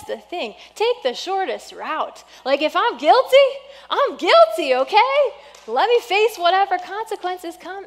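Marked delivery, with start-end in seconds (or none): 2.4-7.9 higher and louder, punching guilty; ringing, raised confession; emphatic preaching. Real, not acted. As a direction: declare it loud and high. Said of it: "preaching emphasis".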